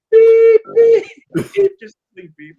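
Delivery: high, loud mock car horn beeps; imitated horn beeps. [name] honking while he parks the bus, mock horn beeps. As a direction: squeak out the horn, beep beep.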